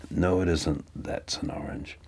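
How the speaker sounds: low whispered retort, imitating a colleague; hushed mock contradiction. Imitating a colleague contradicting himself at a music brief, hushed. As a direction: mutter it almost voicelessly, as an impression. Acting out Pete arguing over orange.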